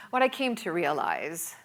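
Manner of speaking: normal talking voice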